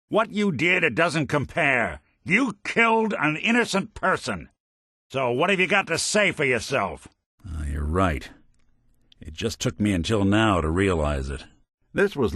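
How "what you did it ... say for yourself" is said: high, indignant demand; outraged accusation. Acted. Accusing a partner who killed an innocent person, outraged. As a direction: confront them and demand an answer.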